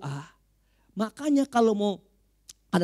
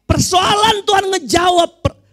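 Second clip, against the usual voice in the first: sudden loud, high pulpit shout; full-force preaching; 11 semitones higher, 10 dB louder than usual. Real, not acted. Preacher on whether God answers, full-force preaching. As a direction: burst out at the congregation.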